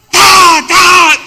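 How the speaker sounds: shouted ta ta like slapping boards together; loud clap sounds. Retold or imitated. Preacher mimics a brother's thunderous clapping, loud and sharp. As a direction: bang out each ta like a hard clap. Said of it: "traen dos tablas en las manos".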